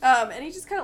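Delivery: ordinary talk, nothing marked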